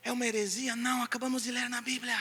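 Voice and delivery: usual speaking voice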